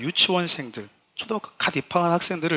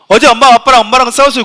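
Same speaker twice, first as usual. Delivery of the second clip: 높고 크게 터뜨리는 아이 흉내; 오자마자 선생님에게 냅다 일러바치듯; 큰 소리로 일러바치기; 7 semitones higher, 12 dB louder than usual. Acted. 교회 오자마자 일러바치는 아이. (동네방네 떠드는 아이 말투)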